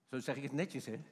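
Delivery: ordinary talk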